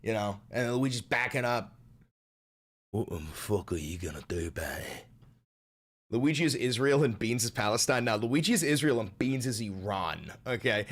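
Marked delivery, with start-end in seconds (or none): 2.9-5.1 low, soft, cocky threat; swaggering tough pet. Acted. Beans to retreating Luigi, low quiet menace. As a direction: taunt quietly, deep in the voice. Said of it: "Just keeps on strutting in".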